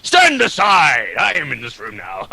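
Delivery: barge in with hammed-up self-importance; pushy asshole act. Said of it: "gotta be more of an asshole".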